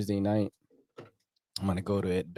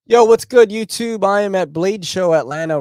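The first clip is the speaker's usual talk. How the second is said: much higher, louder hyped-up greeting; big YouTube hello, pitched way up; hype vlog intro; 9 semitones higher, 9 dB louder than usual. Pretend. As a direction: burst in like a vlogger going live. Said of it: "if I wanna do vlogs".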